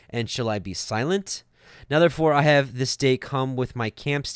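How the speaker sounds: emphatic read-aloud, each verse starting strong then settling; scripture reading. Acted. Teacher reading Esau's answer from Yashar, emphatic recitation. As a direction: declaim the old text in steady, driving phrases.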